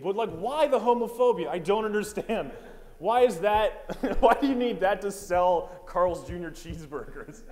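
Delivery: high, loud exasperated rant; incredulous rant. Real, not acted. Comic rants at the burger ad, loud and baffled. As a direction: demand an answer, almost yelling. Ranting at the homophobic ad.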